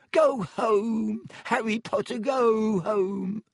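high, wailing elf plea; squeaky, desperate elf cry; frantic house-elf. Acted. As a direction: wail it high, stretching the vowels. Dobby frantically begs Harry to leave.

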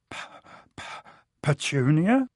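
stammered gasp, rising into a question. A narrator's reading. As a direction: choke on the name in shock. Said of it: "he gasped".

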